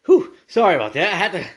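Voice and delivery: real breathless puff after stepping outside; out of breath. Real, not acted. Back from outside, apologizing to listeners, winded. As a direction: catch your breath mid-apology. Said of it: "I'm out of breath".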